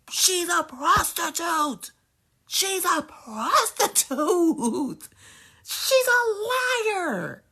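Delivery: slow, stretched mock-crying wail. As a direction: wail it out like fake sobbing. Drawn-out wailing insult.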